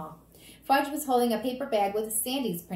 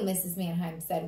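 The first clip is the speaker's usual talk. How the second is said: usual speaking voice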